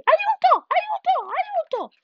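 very high panicked squeals; screaming for help. A narrator's reading. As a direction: squeal for help, fading slightly. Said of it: "urlarono loro". (urla acutissime di aiuto)

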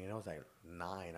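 ordinary talk, nothing marked

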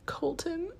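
imitating a small, high, soft sobbing call; tearful call. Imitating a heartbroken contestant calling her boyfriend, tearful. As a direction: call the name high and fragile. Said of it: "you can just hear them both sobbing".

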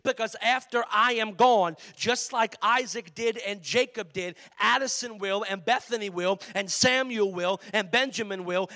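pregação inflamada. Acted. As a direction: preach it out full force to the church. Preaching climax builds loud.

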